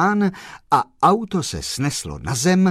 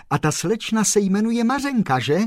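eager question; 6 semitones higher, faster than usual. A narrator's reading. Kolbaba eagerly guessing.

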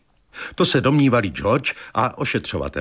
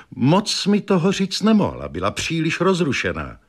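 high-pitched start, worked up; agitated wife; 7 semitones higher than usual. A narrator's reading. Wife reporting the fortune-teller's visit to husband, agitated. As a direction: recount it with raised, agitated pitch. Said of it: "ve stavu velkého rozrušení".

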